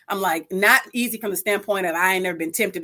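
ordinary delivery

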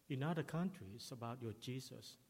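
ordinary talk

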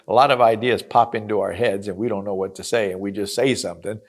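usual speaking voice

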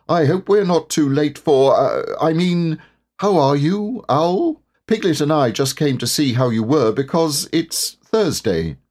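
high-pitched bear fumbling politely; a flustered, sociable Pooh; Pooh, awkwardly polite. A narrator's reading.

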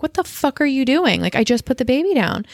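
1 retold alarm. Mother sees husband with baby.

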